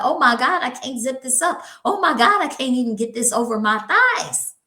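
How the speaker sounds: high, loud mock panic. Woman failing to zip her jeans, hammed-up high panic. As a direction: squeal over jeans that won't zip. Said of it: "honey, you like".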